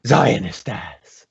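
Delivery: slow, unvoiced whisper; whispered punchline. Real, not acted. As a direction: whisper it slowly for the joke. Punchline about himself.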